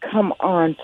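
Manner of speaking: ordinary delivery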